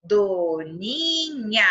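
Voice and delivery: DONINHA drawn out as do, ni, nha; teacher's long-drawn "doninha"; syllable by syllable. Shown as a demonstration. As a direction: say "doninha" very slowly, stretching every syllable. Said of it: "Olha só".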